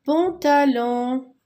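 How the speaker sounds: pantalon slow and clear, syllables apart; slow model word. Shown as a demonstration. Teacher joining the syllables into pantalon slowly. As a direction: draw out pantalon for the class. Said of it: "long et ta et pont".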